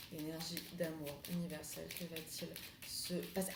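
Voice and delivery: soft murmured ritual call; hushed invocation. Acted. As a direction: breathe the invocation quietly. Tarot invocation.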